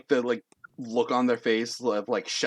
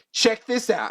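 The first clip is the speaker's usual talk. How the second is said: high, showy call; cocky confidence; 12 semitones higher, 4 dB louder than usual. Acted. Overconfident Dex to the judges, high cocky flourish. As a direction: show off with swagger. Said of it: "confidence of a man who thinks".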